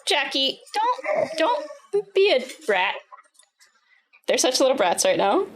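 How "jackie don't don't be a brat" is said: mock scolding. Real, not acted. Scolding dog, slow high.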